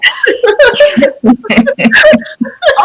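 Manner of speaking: overwhelmed burst of high laughter; loud high laugh. Real, not acted. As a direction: laugh out loud, pitched right up. Overwhelmed by gratitude, breaks into laughter.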